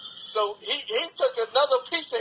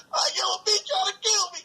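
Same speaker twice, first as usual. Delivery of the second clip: put-on crying kid; 9 semitones higher, faster than usual. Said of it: "you know how kids cry and scream".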